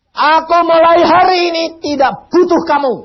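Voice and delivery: mock indignant shout; loud rejection. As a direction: throw the line at him. Man's rejection repeated, shouted.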